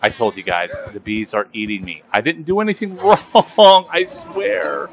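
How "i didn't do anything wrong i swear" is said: laughing plea. Pretend. Pleading with bees, laughing.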